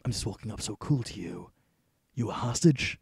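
normal talking voice

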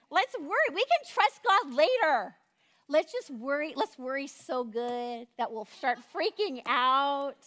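hammed-up tempter pushing worry; flesh urging. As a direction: egg them on to panic like a giddy friend.

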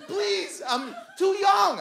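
high, cracking plea, half laughing; panicked pleading. Retold or imitated. Boyfriend begging girlfriend in the bathroom, high panicked plea. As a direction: beg for your life, voice shooting up. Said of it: "I think I was crying".

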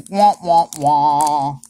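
mock sad-trombone "womp womp"; deadpan womp-womp noise. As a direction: imitate a losing game-show horn, last note drawn out. Mock sad-trombone for a bad pack.

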